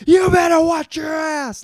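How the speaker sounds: high mock-screamed warning; screaming lines. The good guys threatening each other, mock screaming. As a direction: bellow it like a hammy movie hero. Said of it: "just screaming lines to each other".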